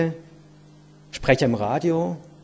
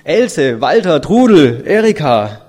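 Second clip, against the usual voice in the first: loud mother's call from a window; raised voice, names drawn out; 10 dB louder, slower than usual. A narrator's reading. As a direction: call the children's names out loudly. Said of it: "öffnete das Fenster und rief".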